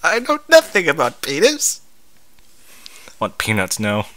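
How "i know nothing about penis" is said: mock character reread. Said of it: "Oh, that's great".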